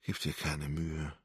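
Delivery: slow, soft, inward bitterness; bitter thought. A narrator's reading. Wendy thinks bitterly at her unconscious grandmother. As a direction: mutter it to yourself, resigned. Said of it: "dachte sie bitter".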